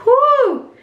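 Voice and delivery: high, loud "whew" falling at the end. Real, not acted. Reader reacting to a heavy spread, high whew. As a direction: let out a high, sliding whew.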